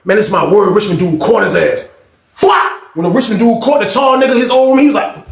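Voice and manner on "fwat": much higher slap sound; mock smack. Richmond dude tackles the tall guy, mock smack. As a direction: snap it like a tackle hitting.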